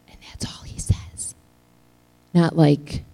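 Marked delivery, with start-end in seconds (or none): 0.1-1.3 soft whisper, barely voiced; preacher whispering to the congregation; whispered aside. Real, not acted. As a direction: drop to a confiding whisper. Quiet aside in sermon.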